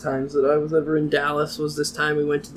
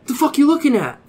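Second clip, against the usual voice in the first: high, loud challenge from a bar stranger; mock confrontational; 13 semitones higher, 5 dB louder than usual. Stranger confronting him at the bar, mock confrontational. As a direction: pick a fight, pitched up high.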